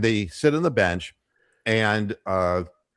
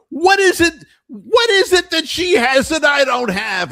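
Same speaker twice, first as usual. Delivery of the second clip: put-on much higher, louder, shrill-free old lady voice; old woman's jealous demand; 8 dB louder than usual. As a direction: voice the hurt old wife demanding. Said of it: "and she says".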